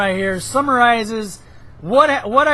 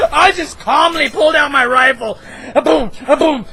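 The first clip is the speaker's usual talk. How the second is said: usual speaking voice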